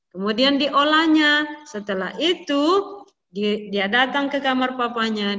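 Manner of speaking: usual speaking voice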